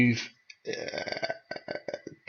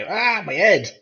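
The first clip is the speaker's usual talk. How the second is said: higher-pitched yelp of pain; acted pain cry; 7 semitones higher, 4 dB louder, slower than usual. Player stumbles back from a blow, acted pain cry. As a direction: cry out as if your head just got hit.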